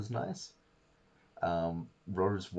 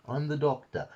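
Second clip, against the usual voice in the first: put-on a light Cockney accent on "I'm the Doctor"; 8 semitones higher, 3 dB louder than usual. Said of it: "that was Cockney".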